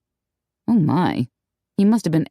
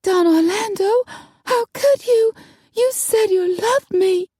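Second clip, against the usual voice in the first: screech. A narrator's reading. Tiffany bursting in on her cheating lover, high screech. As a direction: shriek it, wounded and furious. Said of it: "a screech rented the air".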